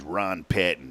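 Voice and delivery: low, soft imitation of a customer; lightly voiced low-key old client; Ron Patton's talk. Telling friend about a former customer, imitating how he talked. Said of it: "that's how he talked".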